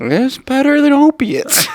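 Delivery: very high-pitched, drawn-out whining; slow, wailing small-child impression; whiny little boy. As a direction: whine it way up high, dragging the words. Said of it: "doing a whiny little".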